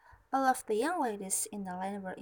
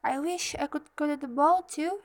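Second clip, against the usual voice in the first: raised, louder wishing voice; said aloud; 5 semitones higher, 6 dB louder than usual. A narrator's reading. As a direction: voice the wish out loud. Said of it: "She said aloud".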